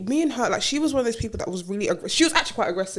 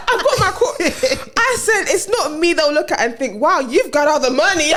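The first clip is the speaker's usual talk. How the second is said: laughing boast; 6 semitones higher, 4 dB louder than usual. Retold or imitated.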